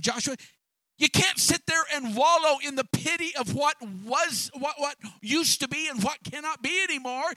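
high, loud urging, voicing God calling Joshua up. Preacher voicing God to a despondent Joshua, loud and urging. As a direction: push him to get up and stop wallowing.

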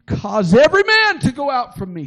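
high, loud command to clear the room; crying out. Acted. Joseph orders the Egyptians out, high and loud. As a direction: Joseph cries out, sending every servant away. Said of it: "and he cried".

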